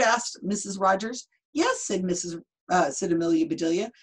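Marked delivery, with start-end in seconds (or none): 1.5-1.8 very high bright yes; chirpy yes. Acted. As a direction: pipe it up cheerfully. Amelia cheerfully answers.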